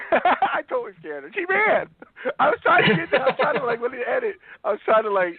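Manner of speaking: giggly and high, laughing through the story; high laughing retelling. Real, not acted. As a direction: laugh while you tell it. Laughing retelling of scaring her.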